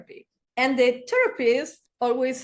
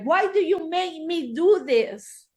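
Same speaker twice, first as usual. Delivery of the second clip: higher, louder accusing abuser voice; mock abuser blame; 6 semitones higher, 4 dB louder than usual. Abuser blaming his partner, loud accusing quote. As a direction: throw the blame at her.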